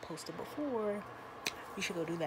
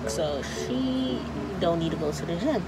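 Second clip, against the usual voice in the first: muffled by surgical mask; talking behind mask; through a face mask; 12 dB louder than usual. Real, not acted. Seen 1: Surgical mask covers her mouth and nose in every labelled frame.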